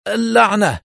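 high, pained curse; cry of pain. A narrator's reading. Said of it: "فصرخ في ألم وأمسك جبهته هاتفا".

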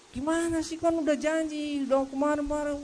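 high-pitched scolding wife voice; a wife scolding her husband in a high put-on voice; angry wife. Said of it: "langsung istrinya marah-marah".

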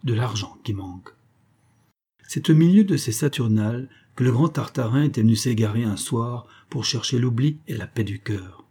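ordinary delivery

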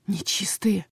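hushed curse under the breath; whispered curse. A narrator's reading. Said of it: "выругалась я про себя".

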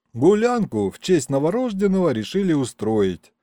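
usual speaking voice

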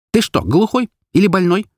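high, furious demand; seething, urgent outburst; choking with anger. A narrator's reading. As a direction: demand it angrily, pitched high. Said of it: "прошипела, захлёбываясь собственной злостью".

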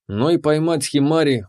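ordinary delivery, nothing marked

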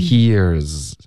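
HERE'S smoothly into a long buzzing z; one joined syllable, "here's"; linked z. Shown as a demonstration. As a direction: hold the z on "here's". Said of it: "你要连发进去这个z".

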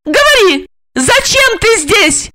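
high, loud female interrogation; frantic high-pitched order, drawn-out question; shrieked demand. A narrator's reading. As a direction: scream at him to talk. Olga screams demands at dying Igor.

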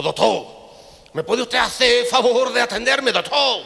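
high, loud, slow desperate plea; a hammed-up pleading patient. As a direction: beg the doctor for help. Patient begging the doctor.

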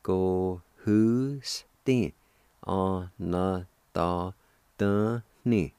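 both parts together, each syllable held separately. Shown as a demonstration. Teacher puts both parts of kindred together, syllable by syllable. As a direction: sound out the whole word slowly, piece by piece. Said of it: "And together".

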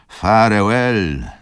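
"farewell" drawn out slowly, loud and falling; slow final farewell. A narrator's reading. Wokulski's last slow loud "farewell" to Izabela as the train leaves. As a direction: let "farewell" sink slowly and loudly. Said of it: "zawołał Wokulski".

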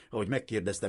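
usual speaking voice

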